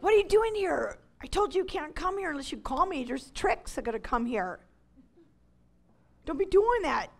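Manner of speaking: annoyed yell. Retold or imitated. Shooing Garrett from her door.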